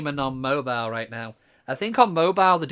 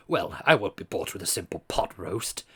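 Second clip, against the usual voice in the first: lower, haughty posh English voice; posh Flint voice; 7 semitones lower than usual. Acted. Flint refusing Nightingale's pot roast bribe, in a low posh English voice. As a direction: look down your nose in a posh accent. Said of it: "so he's gonna stay with the posh".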